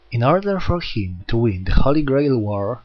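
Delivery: usual speaking voice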